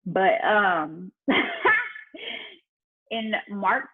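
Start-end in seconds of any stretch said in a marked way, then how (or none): 1.2-2.5 high laugh. Real, not acted. Laughing at husband's comment.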